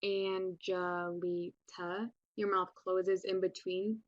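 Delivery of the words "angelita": "Angelita" very slowly, each syllable apart; slow demonstration of the syllables in "Angelita". Shown as a demonstration. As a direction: draw out "Angelita" so every syllable separates. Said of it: "see how many times your mouth opens and closes".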